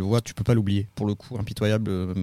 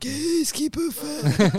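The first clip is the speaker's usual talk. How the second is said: very high, very slow riddle voice; Père Fouras voice; much slower than usual. Pretend. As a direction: drag it out like the riddle keeper. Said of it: "Père Fouras".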